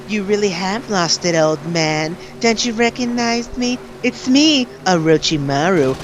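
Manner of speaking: high, taunting villainess voice; Orochimaru. Retold or imitated. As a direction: tease the old man, then reveal yourself. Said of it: "And then she's like".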